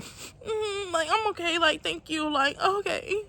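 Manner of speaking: high, tearful voice breaking on each reply; crying while answering. Retold or imitated. To kind strangers at the party, tearfully insisting she's okay. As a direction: choke out reassurances through tears. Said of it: "that shit made me cry a little hard".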